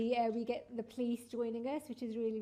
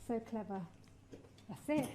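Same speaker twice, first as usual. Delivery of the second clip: ordinary delivery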